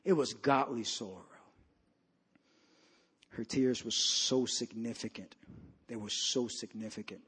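very low, soft, reverent preaching; hushed solemnity. Real, not acted. Preacher honoring a weeping woman's godly sorrow, hushed and solemn. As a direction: drop right down, almost to a murmur.

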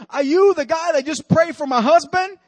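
high, loud disbelief of a wife; acted astonished outcry; incredulous question. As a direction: raise the voice in stunned questioning. Crying wife's astonished question, acted.

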